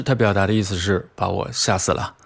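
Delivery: usual speaking voice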